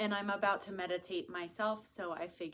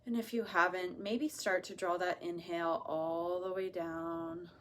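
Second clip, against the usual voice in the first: soft, unhurried meditation voice; hushed, soothing guidance with long pauses; guided meditation; 6 dB quieter than usual. Acted. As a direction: ease them gently into relaxation, softly.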